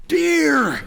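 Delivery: loud, high, fed-up cry of deer; exasperated shout. Retold or imitated. To the confused driver, an exasperated shouted warning. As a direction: blurt it out sighing and exasperated.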